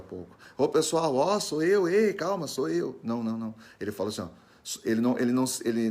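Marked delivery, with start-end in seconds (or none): 0.6-3.0 high, hurried calling to friends; excitable "hey, it's me" greeting, acted; mock casual hail. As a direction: hail the group in a chatty, higher voice. Mock hailing of the disciples.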